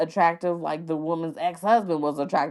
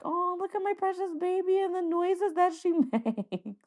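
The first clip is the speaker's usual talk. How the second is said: put-on high, softer cooing over the baby; doting mom; 13 semitones higher, 4 dB quieter than usual. Future mom cooing over her baby, high doting. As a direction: coo like she's holding her newborn. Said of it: "be like".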